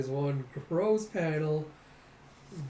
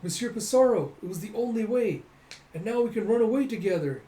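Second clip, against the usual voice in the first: put-on high, louder breathless girl's voice; eager doll girl; 7 semitones higher, 5 dB louder than usual. Doll girl begging her love to flee, high and eager. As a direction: urge him to run away with you. Doll confesses killing, begs escape.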